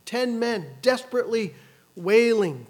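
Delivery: ordinary talk, nothing marked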